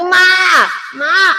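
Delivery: high, loud cry for mom; imitating daughter calling. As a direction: mimic a distressed daughter calling out. Daughter crying out to mother.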